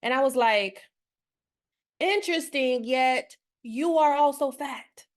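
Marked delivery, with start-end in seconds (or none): 2.0-5.1 pointed retort. Retold or imitated. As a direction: retell it as a measured, pointed observation, words spaced out. Retold retort to her mother.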